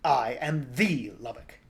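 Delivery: slow, loud monster announcement; pompous Lubbock growl, stretched out; buzzing, snarling creature. A narrator's reading. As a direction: draw it out slowly like a snarling insect. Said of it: "mixture of buzz and snarl".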